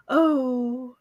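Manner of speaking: lightly voiced, slow princess. Shown as a demonstration. Guest answering the host's request, lightly voiced vain princess. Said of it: "How would you do a princess voice?".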